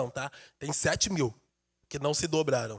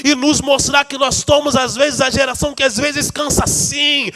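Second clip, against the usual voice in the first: full-force preaching; 2 semitones higher, 6 dB louder than usual. Acted. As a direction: preach it out to the congregation.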